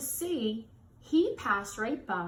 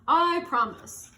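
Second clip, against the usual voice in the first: louder, firm little promise; earnest promise; 4 semitones higher, 6 dB louder than usual. Acted. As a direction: promise it proudly. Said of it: "said Mister Seahorse".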